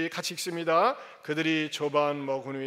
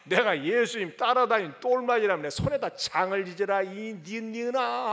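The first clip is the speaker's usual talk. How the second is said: cursing denial; 2 semitones higher, 7 dB louder than usual. Retold or imitated. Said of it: "악담하고 저주하고 그랬어요".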